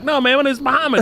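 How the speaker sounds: put-on a flustered kid correcting them; retold younger self. Young self answering gang recruiters.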